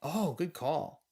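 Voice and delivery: ordinary talk, nothing marked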